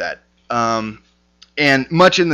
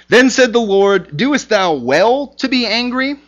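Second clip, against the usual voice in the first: high, louder proclaiming of the question; scripture reading; 9 semitones higher, 3 dB louder than usual. Acted. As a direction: read God's question with weight. Reads scripture aloud.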